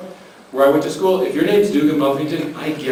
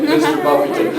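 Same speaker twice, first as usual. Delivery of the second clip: mock-incredulous, pitched-up repeat of the name; suspicious echo; 12 semitones higher than usual. Narrator questioning the odd name on the card, suspicious. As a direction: say the name high, as if it must be a joke. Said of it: "right away I'm suspicious".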